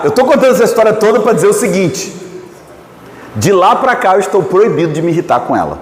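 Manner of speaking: ordinary talk, nothing marked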